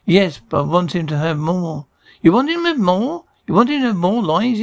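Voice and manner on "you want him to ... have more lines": high-pitched disbelieving question; incredulous. Acted. Director to man wanting more lines for a friend, incredulous. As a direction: question it as if the request is outrageous.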